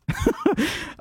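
a high, gleeful laugh; real delighted laugh. Real, not acted. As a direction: laugh at how well it worked out. Laughing at the Indians' deal.

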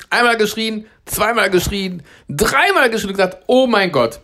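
high, mock-thrilled retelling; retold screaming excitement. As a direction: recount your own screams without actually shouting. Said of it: "da hab ich richtig geschrien".

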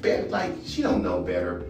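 normal talking voice

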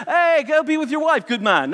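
high-pitched mock British gent; hammed-up British voice for a king; British accent. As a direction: put on a jolly-chap British accent. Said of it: "I don't know why he sounds British".

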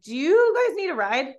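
high, loud retold offer; blurted offer.

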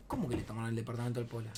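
desconcierto contenido. Retold or imitated. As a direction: ask back quietly, in disbelief. Él responde bajo y desconcertado. (pregunta baja y rápida, incrédula)

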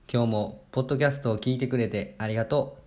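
usual speaking voice

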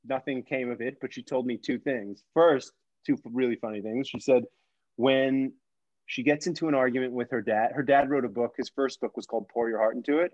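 normal talking voice

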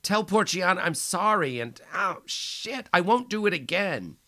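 high, loud anguished begging; sobbing plea. Acted. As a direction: plead through pain and tears. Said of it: "he sobbed".

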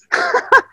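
short high chuckle; brief, soft, high-pitched laugh; real chuckle. Real, not acted. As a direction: laugh lightly at your own story. Laughs at own marriage anecdote.

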